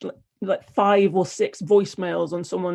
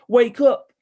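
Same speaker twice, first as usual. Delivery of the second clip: loud, raised performed yell; screamed wake-up call; 5 semitones higher, 7 dB louder than usual. A narrator's reading. Samantha screaming into a voicemail, loud performed yell. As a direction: shout it high in two punches. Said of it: "leaves long voicemails screaming".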